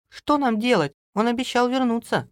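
very high woman's voice; worried wife. A narrator's reading. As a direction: ask anxiously, pitched right up. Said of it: "спросила Алиса бабушку". (тревожная Алиса, высоким голосом)

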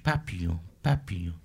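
gentle, hushed delivery; soft, beautiful French. Shown as a demonstration. Comedian showing off a beautiful French word, softly and delicately. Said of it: "equally as beautiful in French".

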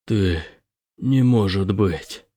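slow, hushed and stunned; barely getting the words out; stunned disbelief. Acted. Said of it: "и не вымолвить ни слова".

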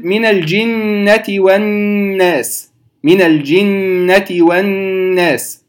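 الجنة والناس بغنة ممدودة ببطء; ghunna demo. Shown as a demonstration. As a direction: stretch the nasal hum on الجنة slowly. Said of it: "هذه نون مشددة أصلية". (نطق الغنة في الجنة ببطء)

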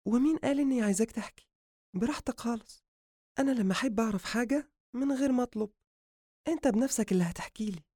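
confident high-pitched female character; self-assured woman. Acted. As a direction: retort coolly in a high woman's voice. Narrator voices woman.

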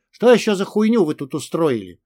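ordinary delivery, nothing marked

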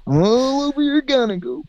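very high squeaky voice; high falsetto. Acted. Comic falsetto bit.

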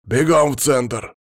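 slow, loud order; loud command. A narrator's reading. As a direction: bark the order out slowly. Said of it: "воскликнул Палыч".

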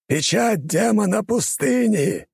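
high, slow booming spell cry; shouted incantation. Acted. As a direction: roar out the demon's seal. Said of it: "кричит Бархан".

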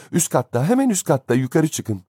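very high, panicky directions; frantic cry. A narrator's reading. Horrified nurse sends the father upstairs, frantic cry. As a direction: send them away in a high-pitched fluster. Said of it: "diye çılgınca bağırdı".

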